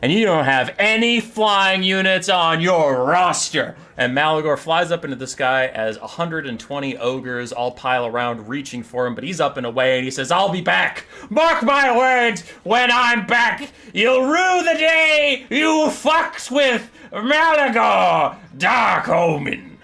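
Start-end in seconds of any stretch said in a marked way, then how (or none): 0.0-3.8 drawn-out, raised-voice boast; hammed-up gloating, higher and louder; loud taunt. Malagor taunts the ogres. 10.3-19.8 high, drawn-out theatrical yell; booming, stretched cartoon-villain shout; villain's shouted vow. Villain shouts a parting vow while fleeing.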